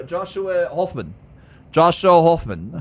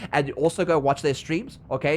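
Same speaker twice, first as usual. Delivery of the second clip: usual speaking voice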